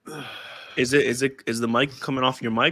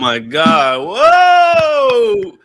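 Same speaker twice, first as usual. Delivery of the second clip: loud, stretched amazed yell; surprised shout; 7 semitones higher, 11 dB louder, much slower than usual. Real, not acted. Mic suddenly works, reacting.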